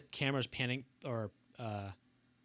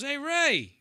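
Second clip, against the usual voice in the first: much higher, louder hey; mock greeting, much higher and louder; De Niro greeting; 13 semitones higher, 9 dB louder than usual.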